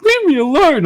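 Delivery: high, loud, whiny plea; mock the crying cousin, high and loud; upset cousin. Crying cousin behind door.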